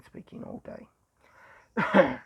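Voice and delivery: slow, worn-out squeaky voice. Real, not acted. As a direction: voice worn thin from talking all day. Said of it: "my voice is going squeaky".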